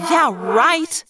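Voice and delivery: jeering. A narrator's reading. Rachel rejects being left as backup, jeering. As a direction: throw it back with open scorn. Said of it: "I jeered".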